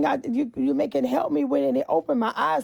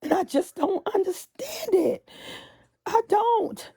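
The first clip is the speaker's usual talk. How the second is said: crying; 6 semitones higher, slower than usual. Real, not acted. Crying over community violence.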